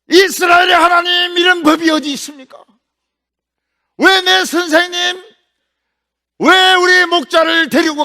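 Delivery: high, loud, anguished shouting; angry outcry. Acted. Elisha crying out to God as his teacher is taken, furious shout. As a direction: cry out to heaven in furious grief. Elisha cries out after Elijah.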